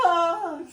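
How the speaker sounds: high, loud, creepy mock cry; loud imitated squeal of a noise. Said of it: "he made a creepy ass fucking noise".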